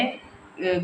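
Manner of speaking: ordinary talk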